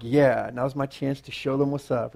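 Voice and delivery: low, quiet and cool; self-assured kid keeping it low-key; acting cool. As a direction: play it cool, low and understated. Playing himself as cocky kid.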